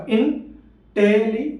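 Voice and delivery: इंटेलि- very slow, broken after "in"; syllable demonstration. Shown as a demonstration. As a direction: stretch इंटेलि- out in separate beats, then stop short. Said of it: "आपका जीभ कितना बदला".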